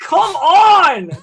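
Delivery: high, loud, frustrated cry; real high-pitched frustration; exasperated yell. Real, not acted. Exasperated at the dice.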